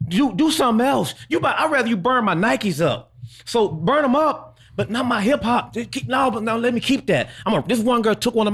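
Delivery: high, agitated protest; worked-up pleading; impassioned serious rant. Real, not acted. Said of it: "My man's serious about this".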